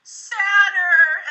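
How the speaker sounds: demanding. Shown as a demonstration. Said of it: "And I'll go".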